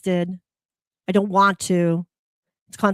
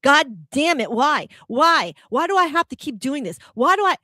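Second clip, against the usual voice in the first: louder, climbing higher to a near yell on the last why; angry rant; 5 semitones higher, 4 dB louder than usual. Acted. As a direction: vent at the VA, building to exasperation. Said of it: "it could be against the V A".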